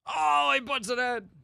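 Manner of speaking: high, drawn-out sportscaster call; announcer's stretched-out high call; Dave Johnson. Acted. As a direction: belt a slow, high play-by-play exclamation. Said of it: "your Dave Johnson".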